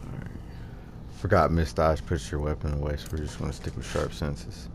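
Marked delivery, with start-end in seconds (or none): none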